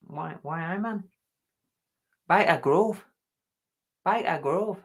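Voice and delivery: Geordie accent. Shown as a demonstration. Showing how Newcastle folk talk, mock Geordie accent. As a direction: demonstrate Geordie with its trigger phrases. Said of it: "up in Newcastle".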